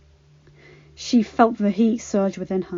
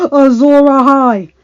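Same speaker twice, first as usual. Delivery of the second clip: louder yell, higher; 5 semitones higher, 12 dB louder than usual. Acted. Gendry raising his warhammer, yelling. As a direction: yell it out hard. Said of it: "yelled Gendry".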